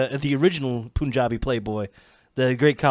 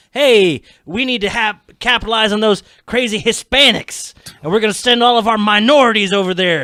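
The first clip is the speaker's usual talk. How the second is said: mock TV executive; 6 semitones higher, 7 dB louder than usual. Mock booker voice, louder.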